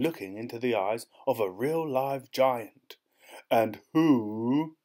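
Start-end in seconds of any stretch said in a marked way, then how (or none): 3.5-4.8 booming giant's question; a giant's thundering demand. A narrator's reading. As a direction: boom it out like a giant. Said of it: "thundered the giant".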